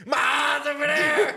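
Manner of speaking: high, loud, laughing; laughing shout. Real, not acted. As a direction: shout it out while cracking up. Laughing after smelling salt.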